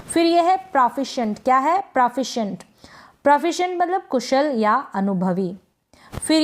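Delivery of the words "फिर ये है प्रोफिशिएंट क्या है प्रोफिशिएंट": usual speaking voice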